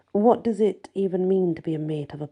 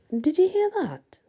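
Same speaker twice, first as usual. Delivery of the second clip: mock surprised, pitched way up; hammed-up innocent surprise; fake surprise; 13 semitones higher than usual. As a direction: overplay being surprised. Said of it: "trying to look surprised".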